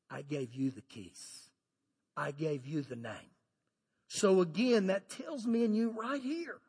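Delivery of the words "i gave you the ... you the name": calm Jesus. Retold or imitated. Jesus voiced softly in story.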